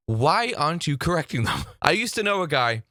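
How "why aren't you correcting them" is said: ordinary talk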